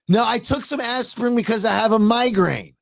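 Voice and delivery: high-pitched mock protest; defensive slurring mother. Mother defending herself to her son, slurred and defensive. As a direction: imitate a woozy mom denying everything. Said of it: "she got defensive and started yelling".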